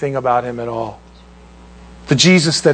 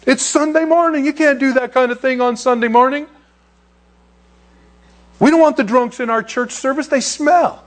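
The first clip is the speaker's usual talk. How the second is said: high, whiny voice of self-righteous church folk; mocking churchgoers; 10 semitones higher than usual. Preacher mocking his congregation's snobbery in a high whine. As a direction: sneer like prissy church people objecting. Religious people scolding, mocked.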